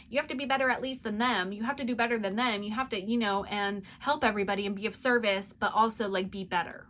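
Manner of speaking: fast, loud nagging-mother voice. Acted. Mother urging her child to do better, mothering voice. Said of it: "like, a mothering voice".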